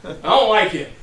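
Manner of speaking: loud grumbling complaint. Acted. King complaining to an ally about his prophet, loud grumbling. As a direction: protest loudly, like an aggrieved king.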